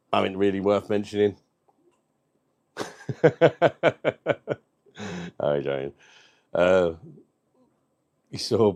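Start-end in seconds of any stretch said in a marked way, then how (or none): 2.8-4.5 real high laugh that tails off; long laugh. Real, not acted. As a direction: burst out laughing at your own joke. Laughs at his own joke.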